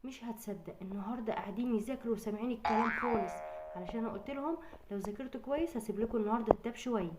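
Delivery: a tired wife greeting her husband gently; soft-spoken mother. Acted. Mother telling the father the girls studied for the tablet, softly.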